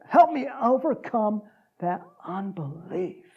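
slow, deliberate delivery; measured, weighty restatement; slow repeat. Acted. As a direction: draw each word out, easing down at the end. Preacher repeats the line deliberately.

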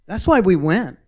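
loud, high preacher's exclamation; emphatic declaration. Real, not acted. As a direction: drive the point home at full voice. Emphatic climax of the sermon.

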